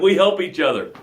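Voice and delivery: ordinary talk, nothing marked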